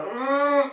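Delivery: high, loud strained grunt; pained grunt as the baby arrives; labour grunt. Retold or imitated. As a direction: push out a retold labour grunt.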